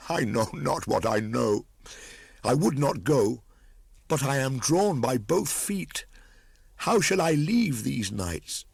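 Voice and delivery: put-on a torn boy on the edge of tears; crying out with a sob. A narrator's reading. Boy crying out to his brothers at parting, anguished. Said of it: "cried Mowgli, throwing up his arms with a sob".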